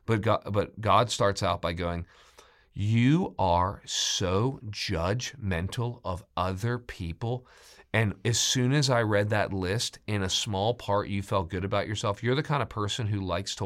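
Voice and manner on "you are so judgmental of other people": very slow, weighted words; God's measured rebuke, acted; deliberate accusation. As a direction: spell out the charge slowly, word by word. Acts God confronting the self-righteous.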